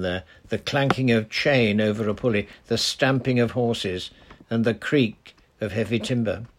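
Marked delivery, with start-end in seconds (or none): none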